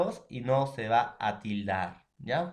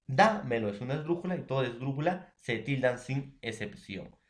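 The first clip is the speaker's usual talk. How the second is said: usual speaking voice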